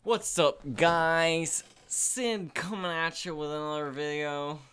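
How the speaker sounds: loud, hyped vlogger greeting; mocking impression. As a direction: mimic a friend's over-the-top intro. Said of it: "I was making fun of you".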